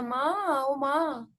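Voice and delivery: half-hearted acted wail; mild mock cry. Mohan calls his mother over stomach pain, mild mock cry. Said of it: "चिल्ला के बोलता".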